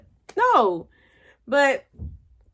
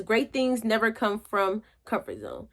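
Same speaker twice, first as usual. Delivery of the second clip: quote reading; 4 dB quieter than usual. Shown as a demonstration. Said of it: "It says".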